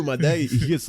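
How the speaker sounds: normal talking voice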